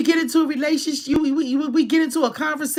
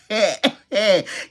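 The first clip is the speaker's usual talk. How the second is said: mock imitation of a teasing laugh; husband's laugh; 3 semitones lower than usual. Imitating her husband's joking laugh, mock laughter. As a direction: imitate his playful laugh. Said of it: "he has this laugh".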